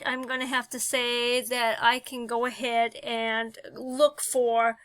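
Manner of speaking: normal talking voice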